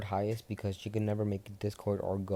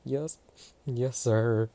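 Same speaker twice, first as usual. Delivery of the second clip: teary yes sir; 4 semitones higher, 7 dB louder, much slower than usual. Acted. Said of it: "Midoriya almost tearing up".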